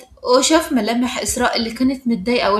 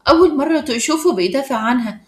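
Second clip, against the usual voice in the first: ordinary delivery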